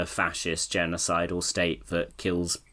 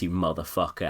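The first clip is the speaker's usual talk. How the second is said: ordinary talk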